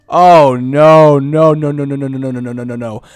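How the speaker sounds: despairing no. Real, not acted. Sees a bad start, despairs.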